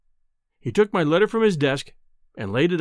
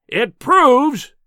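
sudden high, loud blast; furious roar; 10 semitones higher, 9 dB louder than usual. A narrator's reading. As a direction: explode into an enraged bellow. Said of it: "he roared".

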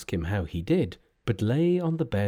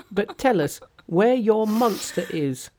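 high, perky monkey king voice; a cocky trickster, pitched up; cheeky monkey hero; 10 semitones higher, 4 dB louder than usual. Acted. Monkey's voice in read story.

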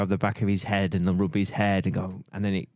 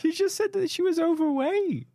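mock indignant high voice.

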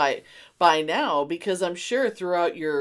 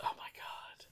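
breathless whispered oh my God; whispered amazement; 18 dB quieter than usual. Real, not acted. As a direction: whisper it in disbelief. Reacting to kept movie poster.

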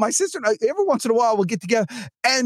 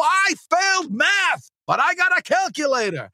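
mock oration; 8 semitones higher than usual.

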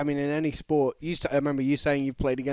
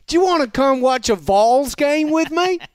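hammed-up Southern fisherman introducing himself; mock Southern charmer; Southern accent; 11 semitones higher, 7 dB louder than usual. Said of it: "would be like a Southern accent".

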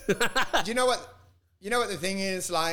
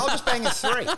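laughing quote; 4 semitones higher, 4 dB louder than usual. Retold or imitated. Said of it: "I'm like".

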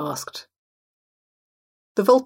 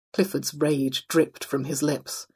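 plain reading voice

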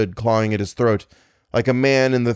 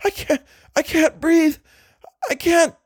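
very high, breathless panic; choking gasp. Acted. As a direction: gasp for air between words. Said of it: "Choking and gasping".

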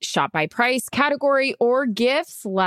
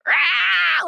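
Elf scream; 8 semitones higher than usual. Retold or imitated. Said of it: "like from Elf with the radiator".

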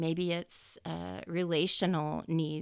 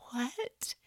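stunned whisper; 6 semitones higher, 5 dB louder than usual. Retold or imitated. Stunned by good news.

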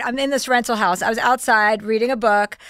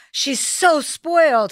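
slow, higher, drawn-out disapproval; grandmother's disapproving quote; 4 semitones higher, much slower than usual. Retold or imitated. Grandmother complaining to mom, slow scolding drawl. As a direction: mimic grandma tutting slowly. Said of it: "my grandmother tell".

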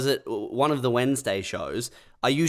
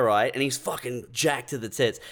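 normal talking voice

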